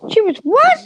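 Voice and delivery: high, rising disbelief; shocked. Real, not acted. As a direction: gasp it out in disbelief. Shocked reaction to Amazing Grace.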